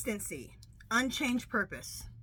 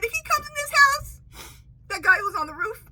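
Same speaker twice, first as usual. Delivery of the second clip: high, loud frantic threat; panicked shout; 8 dB louder than usual. Retold or imitated. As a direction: yell it in a panic. Retold own threat to dispatcher.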